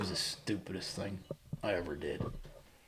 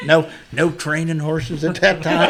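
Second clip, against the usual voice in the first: laughing; 4 semitones higher, 12 dB louder than usual. Real, not acted. Laughing at his own folly.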